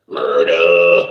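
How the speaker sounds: very low, loud growl; loud mock bass drop; deep murder growl. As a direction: boom it out like a gangster intro.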